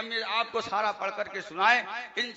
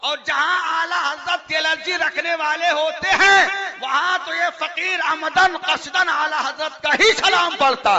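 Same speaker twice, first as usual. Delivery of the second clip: booming preacher's declaration, pitched high; shouting from the pulpit; fiery shout; 7 semitones higher, 12 dB louder than usual. Real, not acted. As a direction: proclaim it over the crowd. Preacher declaring defiance to crowd.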